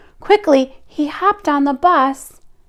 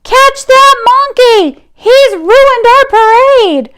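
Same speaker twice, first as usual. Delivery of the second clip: high, slow, louder yell; 10 semitones higher, 5 dB louder, slower than usual. Acted. Band leader yells at the crowd to catch George. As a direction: shout it down the street to catch him. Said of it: "someone yelled".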